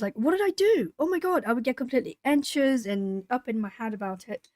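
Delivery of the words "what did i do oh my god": mock panic in a high, swooping voice; high-pitched retold fretting; nervous. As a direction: act out a nervous little freak-out. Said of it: "I would just get nervous".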